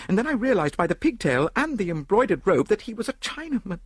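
high-pitched, breathless-paced account of an attack; shaken victim. A narrator's reading. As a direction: relive the attack, voice held high. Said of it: "She stopped with a shudder".

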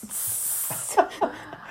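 silent wheezing laugh; airy giggle; breathy laugh. Real, not acted. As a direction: laugh under your breath. Laughing at own joke.

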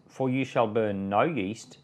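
ordinary delivery

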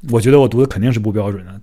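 normal talking voice

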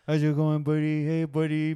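light pet-talk voice; a hint of greeting a dog; understated dog voice. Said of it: "everybody does a dog voice".

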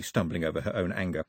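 usual speaking voice

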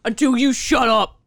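hammed-up British accent, playful defiance. Sister vows not to stop until silenced, exaggerated British accent. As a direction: keep up a broad fake British accent. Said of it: "do this offensive British accent".

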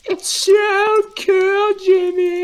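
very high, drawn-out put-on squeal; over-the-top squeaky gushing; mock amazement. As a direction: stretch it out in hammed-up wonder.

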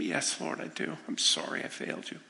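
a hushed, tearful confession, barely voiced; ashamed tearful whisper. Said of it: "through just tears of shame".

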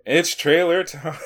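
high, loud, drawn-out echo; hyped echo. Pretend. Co-host echoing the segment name, hammed-up drawn-out cheer. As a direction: stretch it out big and high.

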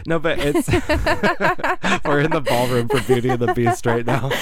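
helpless giggly exaggeration; laughing. Real, not acted. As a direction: crack up at your own joke while saying it. Laughing at own exaggerated comparison.

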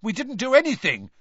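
high, loud indignant denial. Acted. Ron rubbing his elbow, to father, indignant. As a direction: protest your innocence hotly. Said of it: "looking indignantly at his father".

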